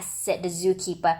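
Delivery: ordinary delivery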